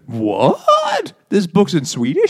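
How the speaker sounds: high-pitched, comic disbelief; astonished. Retold or imitated. Readers discovering the book is in Swedish, mock astonished.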